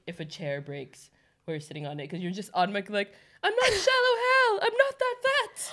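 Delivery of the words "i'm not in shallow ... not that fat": high-pitched whiny protest, hammed up; mock embarrassed wail. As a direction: wail it in a much higher, comic voice. Imagined embarrassed protest after chair breaks.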